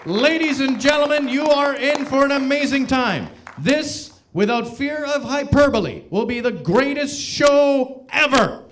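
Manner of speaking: loud, high announcer boom; big hammed-up ringmaster proclamation; over-the-top hype. Host to audience before the show, hyperbolic loud hype.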